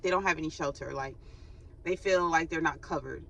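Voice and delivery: ordinary delivery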